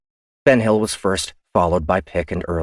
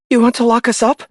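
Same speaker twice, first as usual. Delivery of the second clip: much higher and louder protest; indignant officer; 7 dB louder than usual. Acted. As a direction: push back in disbelief. Ian protests.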